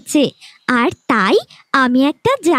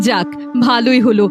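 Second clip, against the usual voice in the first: lower, smug stepmother aside; relieved and scheming, lower; gloating stepmother; 6 semitones lower than usual. Acted. Stepmother gloats she is rid of a burden, lower smug voice.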